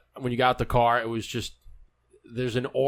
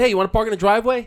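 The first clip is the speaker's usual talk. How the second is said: fast, loud call across the yard, higher; 9 semitones higher, 6 dB louder, faster than usual. Retold or imitated. Host retelling calling out to the arriving guest, loud call. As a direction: call out to him from the house. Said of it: "I asked him, I said".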